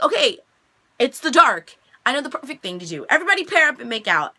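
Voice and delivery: mock-excited call to the crowd; excited announcement. Acted announcement to a party crowd.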